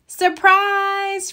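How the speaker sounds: high, bright call of surprise; shouted. A narrator's reading. Franklin shouting to his parents as guests arrive, excited. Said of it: "Franklin shouted".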